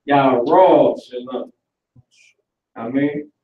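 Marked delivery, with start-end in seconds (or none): 0.1-1.5 "Yahweh Shalom" very slow, yah-RAAAW-sha-lum; Hebrew pronunciation. Shown as a demonstration. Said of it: "I'm saying it so".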